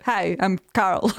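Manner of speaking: slow, laughing Hi, I'm Carl; workman's Carl. Retold or imitated. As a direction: drawl Carl slowly through a laugh. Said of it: "he was like".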